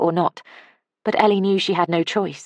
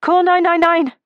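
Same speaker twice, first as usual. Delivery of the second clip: loud, high desperate shout; 14 semitones higher, 7 dB louder than usual. A narrator's reading. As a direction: yell it across the beach. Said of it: "she shouted".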